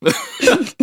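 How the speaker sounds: very high laugh; high-pitched burst of laughter. Real, not acted. Laughing during the banter.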